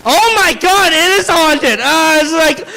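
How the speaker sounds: usual speaking voice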